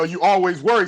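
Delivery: ordinary talk, nothing marked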